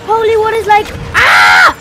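high exclamation rising into a scream; panicked shouting. Real, not acted. Player suddenly attacked in game, panicked shout. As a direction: build from startled words to a loud scream.

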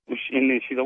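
usual speaking voice

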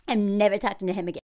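ordinary delivery

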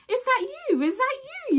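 high squealed double take; high-pitched amazed squeal at a perfume; shocked disbelief. Retold or imitated. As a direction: gasp it out like you can't believe the smell. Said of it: "I was like".

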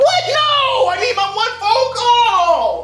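high, drawn-out cry of protest; desperate wail. Acted. Prisoner denied his one phone call, desperate. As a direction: wail "No!" high and stretch the plea.

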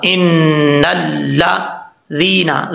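stretched slow recitation of the word. Shown as a demonstration. Teacher slowly recites the word for students. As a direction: draw every sound out. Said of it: "लाम ज़बर ला".

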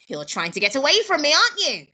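loud, high accusation, rising; accusing grandma. Acted.

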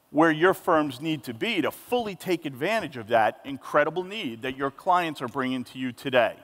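ordinary talk, nothing marked

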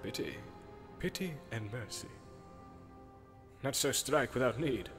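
very soft, measured elder's counsel; distant, hushed rebuke; far-off remembered voice. Acted. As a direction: murmur it like a voice out of the past. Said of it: "quite plainly but far off".

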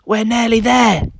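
high, loud, eager hiss of triumph; excited thief. Acted. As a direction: tell your partner you're almost there. Excited thief nearing the cow.

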